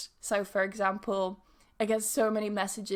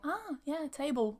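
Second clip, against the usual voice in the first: easy understanding; 5 semitones higher, 5 dB quieter than usual. Pretend. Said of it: "The other person may think".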